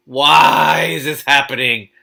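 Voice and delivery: loud, slow, pained wail; exasperated outcry. Real, not acted. Reader, appalled by the scene, wails aloud. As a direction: cry out in disbelief at the book. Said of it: "reader's own outburst".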